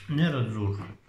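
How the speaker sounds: ordinary talk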